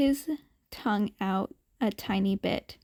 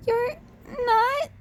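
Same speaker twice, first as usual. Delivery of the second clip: small voice; 12 semitones higher than usual. A narrator's reading. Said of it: "the kid asked in a small voice".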